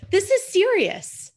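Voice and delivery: high, loud, drawn-out pet greeting; pet talk voice. Real, not acted. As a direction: gush over the cat. Greeting cat named Sirius.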